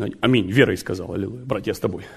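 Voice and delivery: normal talking voice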